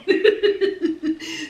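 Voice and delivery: long run of chuckles; real laugh. Real, not acted. Amused by the steamy back room scene, chuckling. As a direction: giggle at the scandal.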